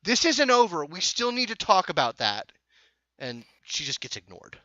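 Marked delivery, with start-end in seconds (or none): 0.0-2.5 loud, high shout from behind; raised voice, demanding to be heard; calling out angrily. Acted. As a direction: shout after someone walking away. Reagan calls out from behind.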